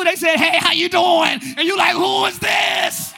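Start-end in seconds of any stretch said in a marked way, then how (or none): 0.4-1.4 loud, drawn-out hello; big mock greeting. Newlywed revealing their true self after vows, big loud greeting. 1.9-3.1 high, loud, stretched-out disbelief; mock shock. Spouse shocked by the partner they married, loud mock disbelief.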